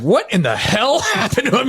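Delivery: high-pitched retold disbelief; hammed-up alarmed astonishment; shocked. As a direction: replay a stunned reaction, voice jumping up. Retold shock at friend in hospital.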